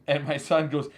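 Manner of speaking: ordinary talk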